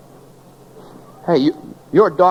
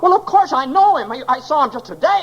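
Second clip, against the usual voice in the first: desperate insistence; 9 semitones higher than usual. Acted. George insists to stranger mother.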